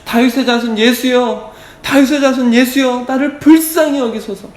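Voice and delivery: high-pitched acted cry for mercy. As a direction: call out high and urgent, short of full force. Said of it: "막 소리를 지릅니다". (높은 목소리로 소리 지르듯이)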